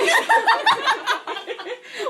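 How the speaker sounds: high loud burst of laughter. Real, not acted. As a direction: crack up loudly. Explosive laugh after joke.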